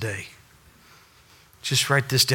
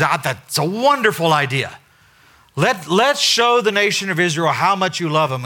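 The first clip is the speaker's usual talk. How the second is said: higher, louder gushing enthusiasm; eager preacher; 7 semitones higher, 5 dB louder than usual. Retold or imitated. Hosea answering God, hammed-up eager preacher. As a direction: ham up the eager yes-man. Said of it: "Hosea, being the preacher, goes".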